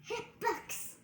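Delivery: breathy whisper; whispered hiccups. Pretend. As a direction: whisper the word. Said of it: "Say it again".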